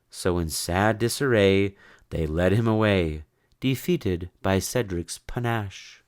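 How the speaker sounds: ordinary delivery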